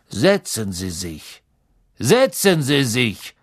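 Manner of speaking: slow, drawn-out teacher's indifference; indifferent Snape. A narrator's reading. Snape waves the late Malfoy to his seat, slow and indifferent. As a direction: say it slowly, unbothered. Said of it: "sagte Professor Snape gleichmütig".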